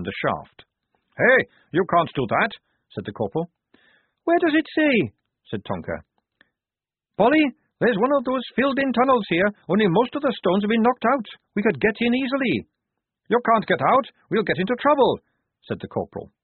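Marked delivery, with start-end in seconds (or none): 1.2-2.5 raised, loud protest; objecting corporal. A narrator's reading. 4.2-5.1 very high, loud comeback; Tonka's defiant retort. A narrator's reading. 7.2-12.7 high, loud, eager report; Tonka calling down. A narrator's reading. Tonka calls down from above. 13.3-15.2 high, loud objection; raised, alarmed soldier's voice; protesting corporal. A narrator's reading.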